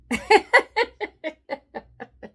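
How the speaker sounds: high burst of laughter; laughing. Real, not acted. Vlogger laughing at showing the world her shame: high laughter. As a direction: laugh at her own joke.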